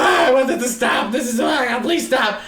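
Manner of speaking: high mock panicked wail; freaking out. Son begging for the seance to end, freaking out. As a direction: plead in exaggerated hysterical terror. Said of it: "the son's freaking out".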